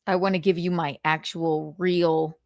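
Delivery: ordinary talk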